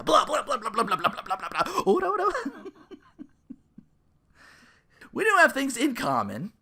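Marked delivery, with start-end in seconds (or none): 0.0-1.8 mocking blah blah. Mocking vampire player. 1.9-2.7 high, loud laughing protest. Real, not acted. Laughing off a joke about vampire players, high and loud.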